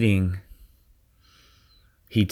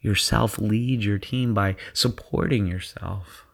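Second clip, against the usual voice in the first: guided meditation. Acted. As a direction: ease them into their zone. Leads a guided visualization.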